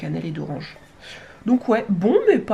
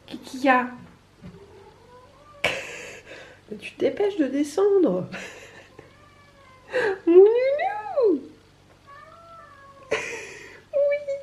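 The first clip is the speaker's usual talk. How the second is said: very high, cooing pet talk; 3 dB louder than usual. Real, not acted. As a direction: squeal sweetly at the cat. Parle au chat, voix aiguë. (voix très aiguë pour le chat)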